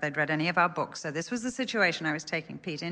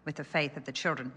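ordinary talk, nothing marked

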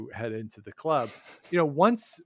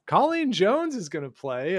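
higher, louder, punched-up name reveal; excited announcement; 7 semitones higher, 6 dB louder than usual. Real, not acted. Host reveals Colleen Jones to listeners, excitedly. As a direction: announce it high and loud with excitement. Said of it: "very excitingly".

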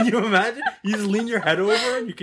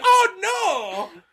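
clumsy infomercial actress; 13 semitones higher than usual. Retold or imitated. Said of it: "And she's like".

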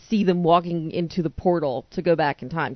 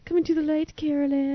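Poltergeist medium; 10 semitones higher than usual. Retold or imitated. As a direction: call her toward the light, ghostly. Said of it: "I was thinking of Poltergeist".

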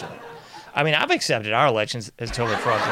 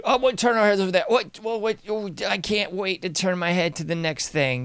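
sarcastic eagerness; 6 semitones higher than usual. Pretend.

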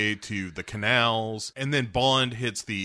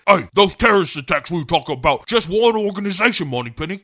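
high, loud, rushed hero voice; Bond; 7 semitones higher, 7 dB louder, faster than usual. Acted. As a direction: blurt it urgently down the phone, hammed-up. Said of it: "Bond calls Moneypenny, and he's like".

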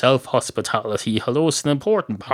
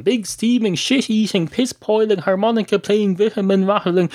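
high-pitched, emphatic list of epithets; mock-grand epithets; 9 semitones higher than usual. Host repeating silly monkey nut names, mock-grand. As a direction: sustain a high, rolling roll call.

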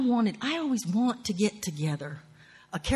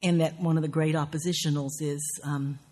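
ordinary delivery

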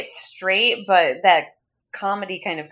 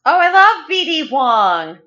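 higher and louder, bubbling with real delight; excited gushing; 7 semitones higher, 4 dB louder than usual. Real, not acted. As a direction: gush about an actor you adore. Excited fan reacting to actor.

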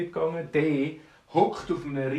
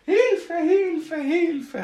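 high, loud acted cries for help; screamed for help; 14 semitones higher, 6 dB louder, much slower than usual. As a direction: yell it over and over in a panic. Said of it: "hat er geschrien".

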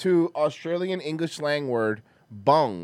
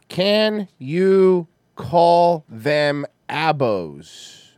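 very slow, loud, word by word; dictating slowly; 6 dB louder, much slower than usual. Real, not acted. Host dictating a search question, slow and deliberate. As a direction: spell it out for a machine.

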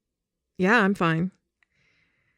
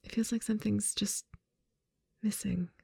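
fading whisper; 7 dB quieter than usual. Acted.

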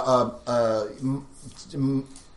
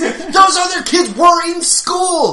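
high, loud mock public service lecture; McGruff PSA voice; 11 dB louder, slower than usual. Lecturing listeners about a kid out at night, mock PSA voice. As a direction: scold like a cartoon crime dog ad. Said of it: "That's some McGruff the Crime Dog shit".

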